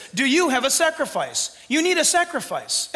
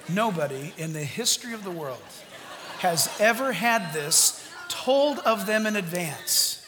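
toned down; 6 semitones lower, 5 dB quieter than usual. Real, not acted. Pastor apologizes to the church and repeats it softer. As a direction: pull it back after yelling. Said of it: "I'm sorry, here you go".

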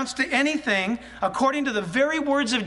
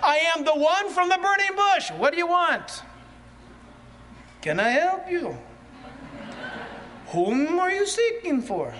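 higher, solemn voice of Jesus speaking; 5 semitones higher than usual. Acted. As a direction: speak as the Lord revealing himself. Preacher voicing Jesus at arrest.

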